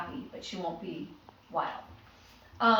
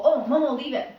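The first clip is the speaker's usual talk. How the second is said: sharp, louder "Oh, Momo"; firm cue; 4 semitones higher, 7 dB louder than usual. Shown as a demonstration. Trainer cueing the puppy, firm and loud. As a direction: call the dog off firmly. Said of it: "you go".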